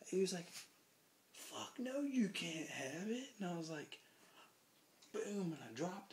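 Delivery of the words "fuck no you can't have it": high, drawn-out mock indignation; stretched, incredulous no; retold outraged refusal. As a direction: imitate a bouncer refusing, voice jumping up.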